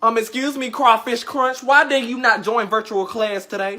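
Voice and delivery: usual speaking voice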